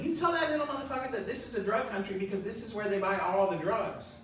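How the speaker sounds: mom's rant. Retold or imitated. Mom telling her son what to say to the kids, fast and indignant. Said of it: "my mom was like".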